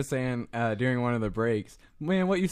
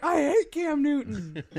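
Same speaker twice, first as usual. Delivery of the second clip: high, drawn-out mock wail; imitated kid freak-out; 12 semitones higher, slower than usual. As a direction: whine it slowly like a heartbroken boy. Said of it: "the kid freaking out".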